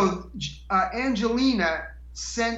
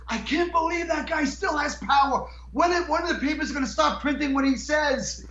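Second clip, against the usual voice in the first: imitating an angry boss, higher-pitched; mock exasperated rant, voice pitched up; retold angry rant; 7 semitones higher than usual. Guest acting out Howard's anger at the newspaper, indignant high-pitched rant. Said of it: "And he was so angry".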